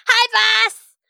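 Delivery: high, loud squeaky sidekick voice; bright high henchman greeting; Steve's voice. Acted. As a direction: chirp it up like an eager little helper. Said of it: "he goes".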